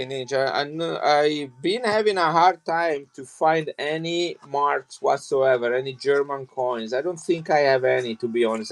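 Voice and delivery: usual speaking voice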